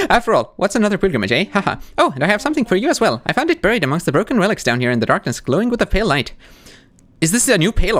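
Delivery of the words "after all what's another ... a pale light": lightly voiced Sherma.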